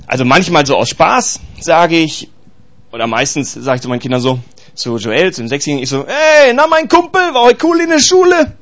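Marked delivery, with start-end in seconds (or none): 6.0-8.5 chummy call, pitched higher; father playfully calling his six-year-old son his buddy, chummy and high; buddy-like greeting to son. Retold or imitated. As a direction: greet him like his best mate. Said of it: "mit meinen Kindern rede ich ungefähr so".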